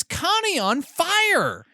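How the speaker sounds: high punched reveal of fire; dramatic reveal. Real, not acted. Telling friend the twist, high shocked emphasis. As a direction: land it like a shock. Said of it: "dramatic reveal".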